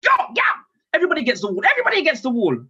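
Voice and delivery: high, urgent command; ordering everyone up. Retold or imitated. Dad ordering his family up to the wall, urgent shouted orders. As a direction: order them about like a raid, pitched high. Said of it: "I ended up telling everyone to get up".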